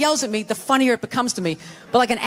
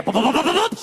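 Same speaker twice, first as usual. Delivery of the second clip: rapid booping sound effect, rising; quick rising run of boops; imitated climbing footsteps; 2 semitones higher than usual. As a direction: mimic feet racing up the stairs. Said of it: "She came back up the stairs".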